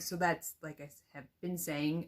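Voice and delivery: ordinary talk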